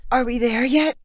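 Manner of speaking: just woken and thrilled to land; sleepy but excited. Acted. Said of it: "in a sleepy but excited voice".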